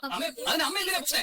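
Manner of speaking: high-pitched, frantic appeal; desperate pleading. Acted. Son begs mother not to leave, desperate pleading. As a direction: beg her, voice pitched right up.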